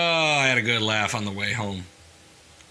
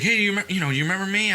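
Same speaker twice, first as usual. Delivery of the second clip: imitating the tipsy guy; 9 semitones higher, faster than usual. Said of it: "He's like".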